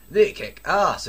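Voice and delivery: hammed-up rocker agreeing; rocker enthusiasm. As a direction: ham up the devil's cheer. Satan agrees in fanfic.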